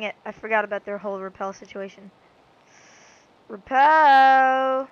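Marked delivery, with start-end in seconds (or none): 3.5-4.9 loud call. Acted. Calling for the item loudly.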